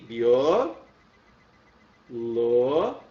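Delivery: bio and lo called out separately, loud; teacher dividing syllables; syllable split. Shown as a demonstration. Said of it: "vamos fazer separação".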